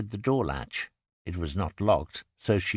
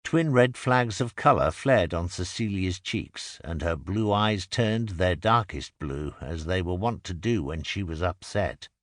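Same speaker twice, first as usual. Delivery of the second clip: plain reading voice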